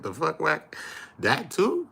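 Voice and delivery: high, startled disbelief; incredulous. Retold or imitated. Reacting to Wack's claims, high disbelief. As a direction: ask it in shock, voice jumping up. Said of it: "I'm like".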